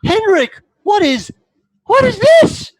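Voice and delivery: very high, loud shocked cry; a mother seeing her son in uniform, shocked; high, loud horrified demand from mother to son. Acted.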